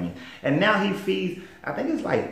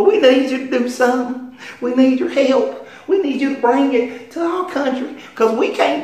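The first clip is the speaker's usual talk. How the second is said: mocking whine; 9 semitones higher, 5 dB louder than usual. People begging a star to fix their country, high mocking whine. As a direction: whine like someone who can't do a thing alone, ending on very high pleas. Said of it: "So don't sit whining".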